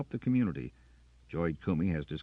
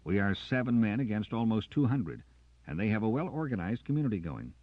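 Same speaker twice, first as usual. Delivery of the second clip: louder, higher hard lecture; stern lecture; 6 semitones higher, 6 dB louder than usual. Acted. Jackson lecturing Carter, louder and stern. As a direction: lay down the facts firmly.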